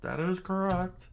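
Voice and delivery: high reply; subordinate voice. Acted. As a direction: answer in a high small voice. Answering officer voiced high.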